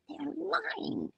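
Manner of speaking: soft, high exasperated interjection; small incredulous squeak; high hushed aside. Real, not acted. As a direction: slip it in high and quiet. Aside while reading the news.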